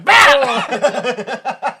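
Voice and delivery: loud high shouted laugh. Real, not acted. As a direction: explode into a shocked laugh. Shocked laugh at joke.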